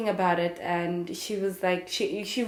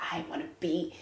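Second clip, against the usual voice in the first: hushed, breathless whisper of a secret dream; whispered wish; 7 dB quieter, faster than usual. Retold or imitated. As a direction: whisper it like a wish you barely dare say. Dreaming aloud of acting.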